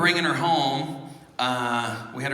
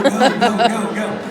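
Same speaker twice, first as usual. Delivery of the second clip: loud excited chant of go; cheering crowd; 6 semitones higher, 9 dB louder, slower than usual. Retold or imitated. Retelling the passengers cheering him on, loud and excited.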